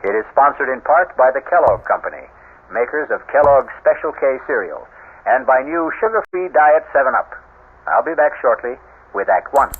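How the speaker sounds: steady announcer voice for credits and sponsors; host announcer read. A narrator's reading. Radio host introducing the drama and sponsors, announcer style. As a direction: present the show like a radio host.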